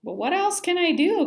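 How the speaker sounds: high, loud, pleading; pleading cry. A narrator's reading. Simon asking grandma for help, high and loud. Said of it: "cried Simon".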